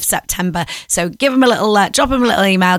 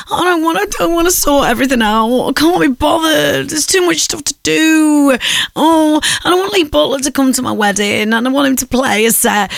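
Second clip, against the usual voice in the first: whiny voice; 6 semitones higher than usual. Acted. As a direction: whine like a stressed client. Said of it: "that big whiny voice that I've just done".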